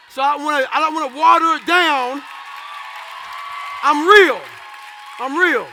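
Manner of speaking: loud, forceful shout; emphatic declaration. Real, not acted. Speaker to audience about not watering it down, defiant shout. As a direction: belt it out over a cheering crowd.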